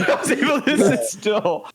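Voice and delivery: laughing. Retold or imitated. Repeating the quote laughing.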